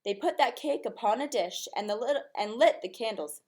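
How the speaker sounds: usual speaking voice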